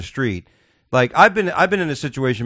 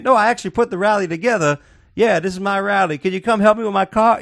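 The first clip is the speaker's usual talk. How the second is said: mock caller; 8 semitones higher than usual. Woman on 911 call.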